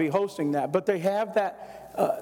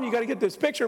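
ordinary delivery

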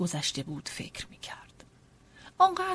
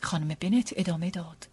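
ordinary delivery, nothing marked

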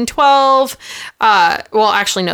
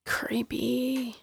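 breathy ghostly whisper; spooky whisper; 16 dB quieter than usual. Acted.

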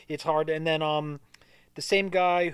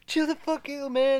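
screaming; 10 semitones higher, slower than usual. Retold or imitated. The actor panics during the raid scene, high mock scream. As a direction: squeal it like he's wigging out.

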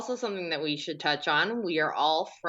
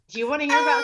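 laughing; 8 semitones higher, 7 dB louder, much faster than usual. Real, not acted. Laughing over screams.

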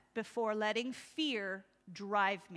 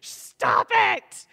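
fed-up shout; 7 semitones higher, 9 dB louder, much slower than usual. Retold or imitated. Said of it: "You're just like".

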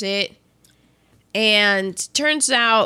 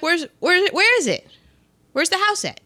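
Ruth voice; 8 semitones higher than usual. Retold or imitated. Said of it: "Ruth's like".

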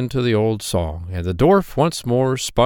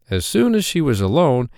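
plain reading voice

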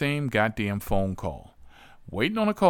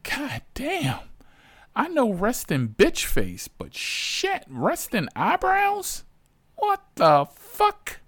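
much higher, shrieking disbelief, slowing at the end; high shocked disbelief; slower than usual. Retold or imitated. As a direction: squeak out the outrage at those eyebrows. Shocked at eyebrows.

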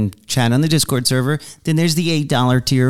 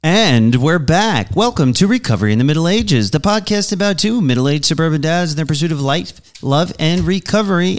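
upbeat show host intro; announcer voice; 4 semitones higher than usual. Acted. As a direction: open the show like a radio host. Podcast intro read.

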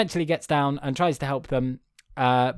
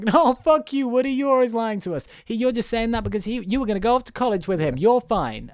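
high, fast indignant toy voice; angry toys; 8 semitones higher than usual. Retold or imitated. Host voices the toys rejecting Woody, high and fast. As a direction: snap back high and quick, mocking. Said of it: "And he's like".

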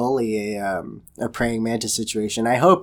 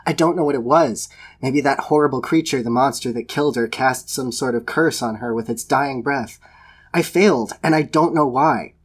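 rising rant. Acted. Mage ranting at Botaniki about his failure, building anguished rant. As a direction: let it build to a desperate outburst. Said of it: "I knew I was beginning to rant".

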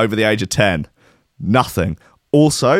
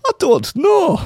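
put-on slow and much higher, the Scottish accent drifting into a shout; wandering Scottish accent; 12 semitones higher, 4 dB louder, slower than usual. Host mocking his own slipping Scottish accent. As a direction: lose the Scottish accent and squawk about it. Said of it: "What on earth is this accent?".

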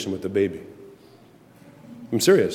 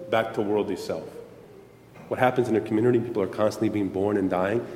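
ordinary talk

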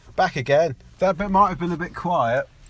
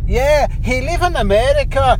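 put-on mild Mexican accent on live in America; a light Mexican lilt, pitched up; mock Mexican accent; 6 semitones higher than usual. Friend doing a weak Mexican accent for a podcast. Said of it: "that's my Mexican".

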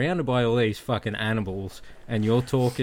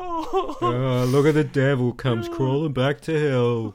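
laughing mockery. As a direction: taunt through laughter. Mocking the devil's return.

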